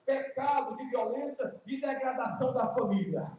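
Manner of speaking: slow, measured pulpit declamation; preaching. Real, not acted. Preacher expounding three faithful men to the church, solemn preaching. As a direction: proclaim it phrase by phrase, drawing out the words.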